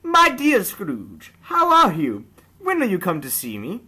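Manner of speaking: cheerful greeter. Acted. Narrator voicing a passerby who greets Scrooge gladly, high and gushing. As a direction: gush a hearty welcome like a delighted old friend.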